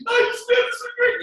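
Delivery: high, loud emotional outburst; mock overwhelmed cry; crying on stage. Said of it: "I'm like".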